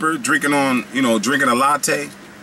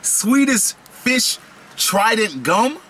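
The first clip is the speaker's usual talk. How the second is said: amazed; 7 semitones higher, much slower than usual. Real, not acted. Reading the package amazed.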